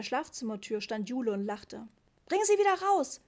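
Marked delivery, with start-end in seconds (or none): 2.3-3.2 loud, high, insistent order; firm command. A narrator's reading. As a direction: order your child with no room for argument. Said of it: "sagte Mama bestimmt". (bestimmt, laut und hoch)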